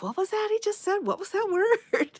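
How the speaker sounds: lightly mocking her own confusion; puzzled self-quote. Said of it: "I'm like".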